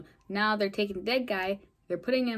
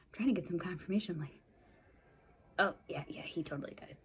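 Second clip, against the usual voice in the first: whispering; 6 dB quieter, faster than usual. Real, not acted. Whispering to someone off mic.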